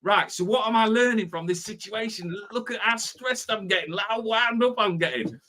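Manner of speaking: a lightly voiced wound-up self, pitched high; voicing a stressed queuer talking to themselves; mock self-talk. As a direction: ask yourself, half-stressed, half-mocking. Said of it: "you're going".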